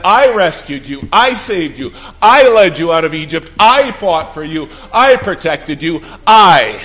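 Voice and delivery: God proclaiming, punching every "I"; emphatic divine declaration. Acted. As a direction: hammer each "I" hard, then let the rest fall. Preacher voices God claiming each rescue.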